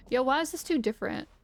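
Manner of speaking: ordinary talk, nothing marked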